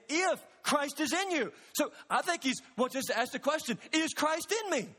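urgent pulpit preaching, slowing on the key lines; preaching at full force. Real, not acted. Preaching climax resumes.

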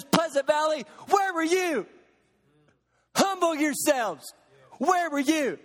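God calling out. Acted. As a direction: call the church out by name. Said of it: "And God says".

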